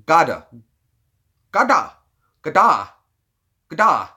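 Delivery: "Gada", Gouda with no U sound; minus the U. Shown as a demonstration. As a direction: loudly test out Gada, dropping the U. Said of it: "Minus the U".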